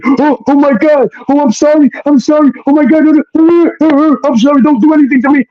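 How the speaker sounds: very high mock-hysterical wail; hammed-up falsetto pleading; panicked apology. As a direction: imitate a frantic woman begging, far above your own pitch. Imitating her panicked apology.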